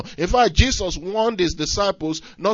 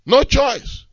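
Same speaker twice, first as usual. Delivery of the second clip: normal talking voice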